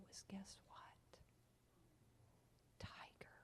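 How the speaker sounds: put-on hushed, breathy reveal for the kids; soft mock-mysterious whisper; suspense whisper. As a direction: whisper it like a secret.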